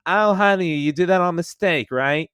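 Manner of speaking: high, loud sugary housewife voice; Harley Quinn voice. Retold or imitated. To the pirate who killed her, a high sugary housewife voice. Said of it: "kept pretending to be that character".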